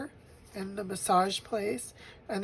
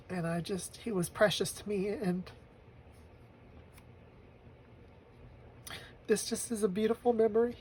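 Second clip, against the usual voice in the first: near tears; 6 dB quieter than usual. Real, not acted. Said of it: "have a good cry".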